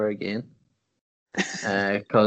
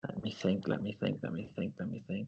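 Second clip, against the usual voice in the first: stalling while picking a pundit, softer each time; trailing mumble; 3 semitones lower, 11 dB quieter than usual. Real, not acted. Stalling over which pundit to pick, fading mutter. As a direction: let me think, fading to a mumble under the breath.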